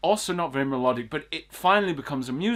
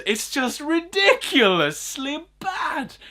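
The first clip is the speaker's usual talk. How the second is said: much higher, slow, strained disbelief; exasperated; 14 semitones higher, 4 dB louder, slower than usual. Real, not acted. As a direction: wail it out in exasperation. Exasperated verdict after mock song.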